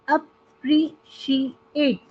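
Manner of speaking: Appreciate slowly as अ-प्री-शी-एट; four separated beats on appreciate; syllable by syllable. Shown as a demonstration. Teacher giving another four-syllable word, syllable by syllable. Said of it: "four syllables होते हैं".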